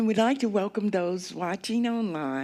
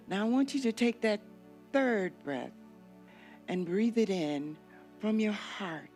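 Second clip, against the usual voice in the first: guided meditation; 5 dB quieter than usual. Acted. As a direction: lull them gently down the river.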